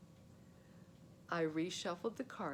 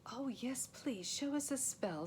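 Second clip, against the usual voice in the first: lady's voice; 6 semitones higher than usual. Acted. Queen asks sweetly.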